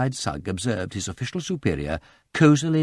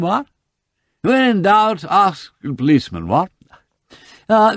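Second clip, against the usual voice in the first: loud, high, tipsy toff rambling on; drunk gentleman; 4 semitones higher, 5 dB louder than usual. Acted. Lord Peter greets the policeman, loudly and drunkenly. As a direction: slur it like a cheerful drunk.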